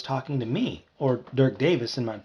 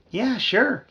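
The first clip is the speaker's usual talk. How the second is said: ordinary delivery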